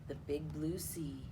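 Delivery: ordinary delivery, nothing marked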